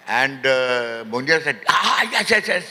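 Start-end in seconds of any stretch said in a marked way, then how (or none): 1.7-2.7 high, gushing yeses; acted-out sudden enthusiasm, pitched high; eager agreement. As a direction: imitate an excited assistant agreeing.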